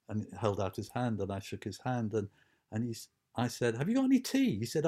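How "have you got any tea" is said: cheeky request. Retold or imitated. Own cheeky quote to George.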